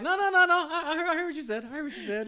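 high, loud squeaky denial; mocking impression of Mike. Co-host mimics Mike insisting he heard right, high and loud. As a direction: squeal it out like a flustered guy covering his mistake. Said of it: "He was like".

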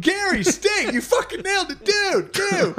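high hyped yelling at Gary; hype shout. Retold or imitated. Imagined friend hyping Gary a second time. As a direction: scream praise like a stoner buddy. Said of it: "some dude was like".

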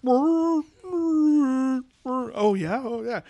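high yowling Wookiee impression; Wookiee growl. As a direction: howl it like a Wookiee. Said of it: "Wookiees growling at each other".

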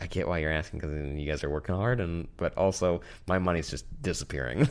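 quiet. Retold or imitated. Tired reply to friends.

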